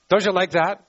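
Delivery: ordinary talk, nothing marked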